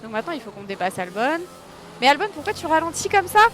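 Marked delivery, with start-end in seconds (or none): 2.0-3.4 exasperated. Real, not acted. Frustrated at slowing car.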